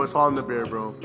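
ordinary talk

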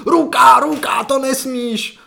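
high shouted call of a foul, slowing on the last words; loud mock kids' yell at the boy who grabbed the ball; kids shouting. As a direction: yell it like boys on the pitch calling a handball. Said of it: "pět kluků na tebe začne křičet".

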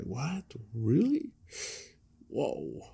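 hushed, mock-puzzled disbelief; quiet bewilderment. Preacher acting out believers puzzling over hard teachings, quietly bewildered. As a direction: softly act out being taken aback.

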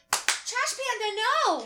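high, drawn-out scolding yell; mock screaming. Woman screaming at a raccoon tearing a linen bag, mock scream. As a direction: comically scream at a misbehaving animal.

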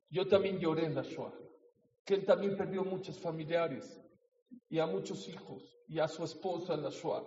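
ordinary delivery

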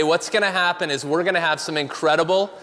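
ordinary talk, nothing marked

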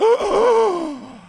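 sharp high gasp of mock offence; shocked gasp. Mocking the lady who got offended, sharp high gasp. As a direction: gasp as if deeply insulted.

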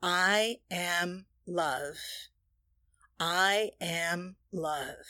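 slow, soft, drawn-out I am love; slow affirmation. Shown as a demonstration. Leading the listeners in a soft, slow affirmation. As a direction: let each word sink in, quietly. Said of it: "say it together with me".

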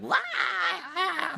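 very high comic wail; mock high-pitched screech; imitated demon wailing. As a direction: wail like demons trapped in a bag. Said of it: "making all this sound".

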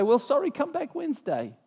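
high, breezy brush-off voice; mock brush-off. God as dismissive receptionist.

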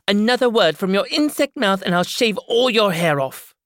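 put-on woman threatens the girl, loud and high; threatening woman character. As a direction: scare the girl into silence.

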